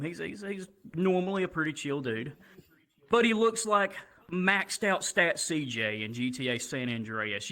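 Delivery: usual speaking voice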